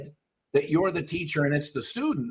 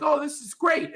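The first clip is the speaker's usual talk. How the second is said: much higher, gleeful puppy; happy puppy; 11 semitones higher than usual. Retold or imitated. Trainer voicing a carefree puppy running ahead, much higher. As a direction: squeak it out like a happy pup. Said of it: "the puppy's like".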